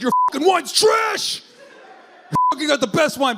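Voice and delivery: high, loud mock outrage; outraged wine snob. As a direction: shout it like an indignant wine snob. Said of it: "anybody else would be like".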